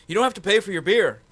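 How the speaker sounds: high, loud, quick happy offer; excited quote. Retold or imitated. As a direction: rush out the good news. Retelling staff's happy offer.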